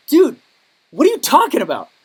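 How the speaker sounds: offended retort. Retold or imitated. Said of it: "I was like".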